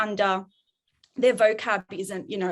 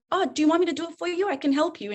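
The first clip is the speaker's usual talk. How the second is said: eager helper; 7 semitones higher, faster than usual. Retold or imitated. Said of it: "And I was like".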